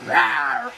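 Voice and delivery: loud mock bear roar to scare it off. Shown as a demonstration. Live viewers shown how to scare a bear, roaring. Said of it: "you got to go".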